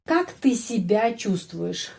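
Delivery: Как ты себя чувствуешь read slowly and clearly; slow reading demo. Shown as a demonstration. Teacher reading a Russian question slowly for learners. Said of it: "Как gampang dibaca".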